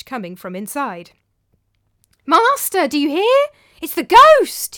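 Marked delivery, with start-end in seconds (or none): 2.3-4.8 high, loud panic for show; alarmed cry. Acted. Servant blocks master from the house, crying out in alarm. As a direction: shout a frantic warning. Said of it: "he cried".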